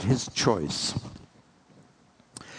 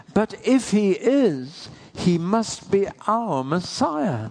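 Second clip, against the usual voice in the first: put-on young woman's realization; 7 semitones higher, slower than usual. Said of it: "And she said".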